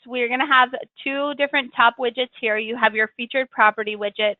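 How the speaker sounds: normal talking voice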